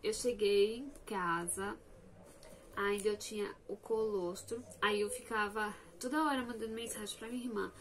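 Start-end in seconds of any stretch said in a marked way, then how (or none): none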